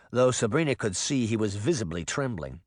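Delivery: usual speaking voice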